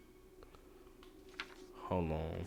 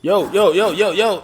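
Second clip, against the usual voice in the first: loud, higher yo-yo chant; excited calling; 7 semitones higher, 9 dB louder, slower than usual. Real, not acted. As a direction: call out hyped.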